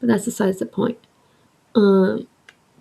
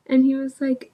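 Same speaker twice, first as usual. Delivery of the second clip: normal talking voice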